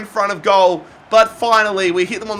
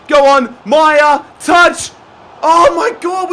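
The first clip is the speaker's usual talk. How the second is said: rising excited shouts; 7 semitones higher, 6 dB louder, slower than usual. Real, not acted. Late chance.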